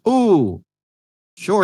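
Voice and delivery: high, loud eager "ooh, sure". Retold or imitated. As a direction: jump at it eagerly. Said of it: "and I was like".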